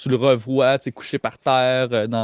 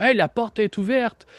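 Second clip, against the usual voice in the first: higher-pitched acted call; arriving henchman; 9 semitones higher than usual. Game master voicing people arriving, acted high-pitched call. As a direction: call out in an approaching guard's voice. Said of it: "des voix. Il y a des gens qui arrivent".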